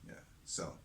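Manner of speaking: ordinary delivery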